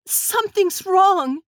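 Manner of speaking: high, slow cry of terror; panicked plea; terrified cry. Acted. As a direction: cry out as the sparks engulf her. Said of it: "She cried out in terror".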